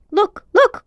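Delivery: high, loud excited cry; boy crying out at the ring, high and loud. A narrator's reading. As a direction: call out in sudden discovery. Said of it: "cried Diggory".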